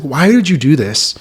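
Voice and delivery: a retold furious why at a lost friend; angry question. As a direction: demand it of him in remembered anger. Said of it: "I was just so angry at him".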